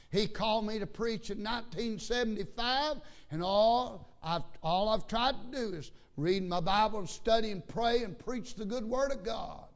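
loud, high pulpit voice; forceful preaching. Real, not acted. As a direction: drive it home to the congregation. Preaches with raised voice.

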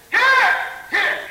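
loud high cry of here; calling out. Acted. Wounded soldier calling loudly to the nurse. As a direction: call out across the field, loud and high.

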